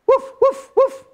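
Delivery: high yappy woofs; puppy barking. Acted. Storyteller voicing Biscuit answering the girl, high puppy barks. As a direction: bark like a tiny puppy.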